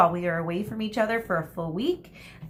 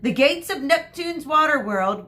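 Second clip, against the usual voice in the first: higher, louder announcement; announcing; 7 semitones higher, 6 dB louder than usual. A narrator's reading. Teacher announcing the water world trip to the children. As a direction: proclaim it to the bus.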